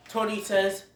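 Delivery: ordinary delivery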